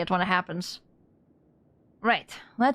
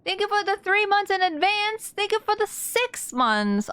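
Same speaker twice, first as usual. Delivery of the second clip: high, sing-song thank you; cutesy thanks; 10 semitones higher than usual. Real, not acted. Streamer thanking a subscriber, high and cutesy. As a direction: squeak out a sweet thank you.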